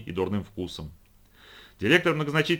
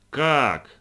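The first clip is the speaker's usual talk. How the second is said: a louder surprised cry; exclaiming; 4 semitones higher, 6 dB louder than usual. A narrator's reading. Florence exclaiming at her uncle's purchase, surprised cry. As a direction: blurt it out in shock. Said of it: "воскликнула Флоренс".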